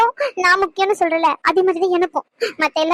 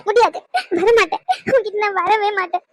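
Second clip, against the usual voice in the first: tearful, sobbing through the words; a hurt lover crying; crying refusal. Acted. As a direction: refuse him while weeping.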